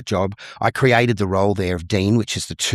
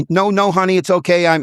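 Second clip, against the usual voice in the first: put-on higher, louder American accent; 10 semitones higher, 4 dB louder than usual. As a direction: slip into American, pitched up for the wife. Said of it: "still speaking with an American accent".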